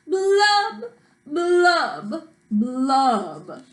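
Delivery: drawn-out blubs, stepping down in pitch; loud-ish, droning pouty fish refrain; glum fish blubs. Acted. Pouty fish's refrain in story.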